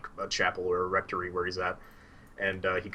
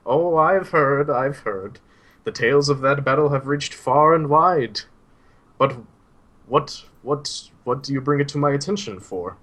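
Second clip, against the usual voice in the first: put-on higher, louder old man's voice, slow start; a kindly old mentor, animated and raised; old Suhail; 6 semitones higher, 9 dB louder than usual. Old teacher answering his student while strolling, higher and louder. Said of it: "and he's like".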